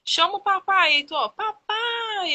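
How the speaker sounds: high, loud coaxing, papai stretched slowly; coaxing child. Retold or imitated. Mother coaxing her son to call his father, high sing-song. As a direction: coax the toddler to say papai. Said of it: "eu ficava assim".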